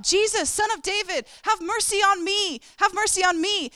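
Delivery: high, loud pleading cry; desperate shout. Retold or imitated. Blind beggar shouting to Jesus, loud and desperate. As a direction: shout it over the crowd. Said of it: "Bartimaeus is shouting out".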